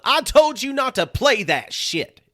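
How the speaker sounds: high, loud, slow exasperated yell; angry yelling. Retold or imitated. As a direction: yell at the sound guy, fed up. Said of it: "he would get hype at the, at the sound guy".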